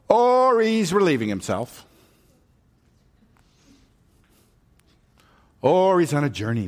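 high, loud taunting jeer; mocking Elijah. Elijah mocking the prophets of Baal, high and loud. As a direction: sneer the next excuse. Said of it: "Elijah mocked them".

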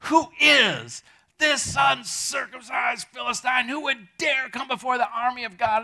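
loud, slow, in-your-face shout; David shouting trash talk at the giant. Retold or imitated. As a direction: taunt the giant to his face. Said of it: "trash talk, kind of. Just like in his face".

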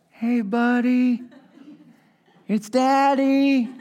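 slow, tender greeting to the unborn son; tender baby talk. Retold or imitated. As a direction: coo it slowly into the belly. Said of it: "Talk to the baby through the belly button".

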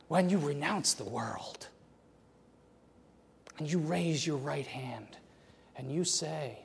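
whispered, solemn vow setup; hushed whisper. Acted. Preacher whispering to the congregation before the vow. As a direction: whisper it like a sacred secret.

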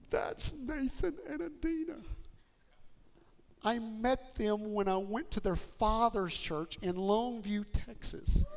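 retold tearful woman, high and strained at first; imitated weeping. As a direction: voice her through tears, slowing down as she goes. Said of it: "and starts weeping".

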